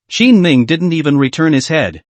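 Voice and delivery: ordinary delivery, nothing marked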